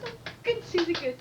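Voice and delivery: high, cooing teacher's praise; praising teacher. Acted. Teacher praising Susie's answer, high and encouraging. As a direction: reward the child with lifted pitch.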